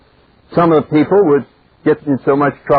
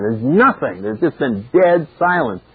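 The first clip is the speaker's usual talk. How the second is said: ordinary talk, nothing marked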